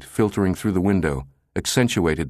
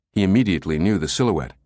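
ordinary delivery

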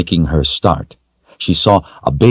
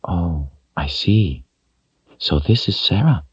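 gentle; 6 dB quieter than usual. A narrator's reading. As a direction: soothe a frightened child. Woman gently soothes child.

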